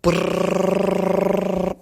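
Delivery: high, very loud rattling ring; alarm clock ring. Acted. Narrator voicing the alarm clock, loud ringing. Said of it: "went the alarm clock".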